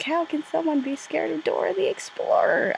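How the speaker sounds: high, mocking skeptical voice; mocking viewer. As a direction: squeak out a disbelieving question. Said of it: "you're probably thinking something like".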